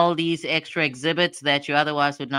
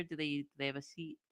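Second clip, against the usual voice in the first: usual speaking voice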